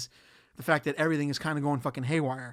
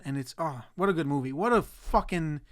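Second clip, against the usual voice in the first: normal talking voice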